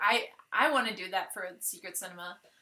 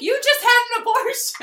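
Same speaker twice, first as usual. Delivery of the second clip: high, loud outburst in character; acted-out loud, high exclamation; mock exclamation; 13 semitones higher, 9 dB louder than usual. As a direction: blurt it out at a much higher pitch. Mock exclamation in retold scene.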